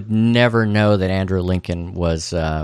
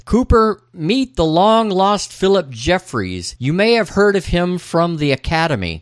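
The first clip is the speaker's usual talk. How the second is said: much higher, projected character voice; young Gordon announcing; 9 semitones higher than usual. Acted. Podcaster voicing Gordon introducing a colleague, projecting. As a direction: announce the introduction grandly, pitched up.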